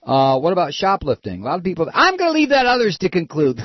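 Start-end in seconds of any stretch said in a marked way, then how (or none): 1.9-3.6 high, loud mock quote; mocking politician's evasion. Host mocks Senator Clinton's evasive answer, high mocking quote. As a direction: parrot the evasive answer at a raised pitch. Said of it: "it's gonna sound like".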